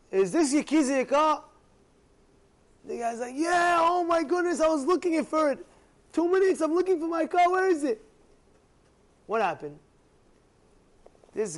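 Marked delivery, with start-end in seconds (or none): 0.1-1.4 high, loud retold call; urgent question. Retold father calling to a stranger. 3.4-8.0 high, loud, frantic retold outburst; frantic relief. Retold frantic car owner finding car.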